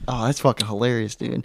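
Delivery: ordinary talk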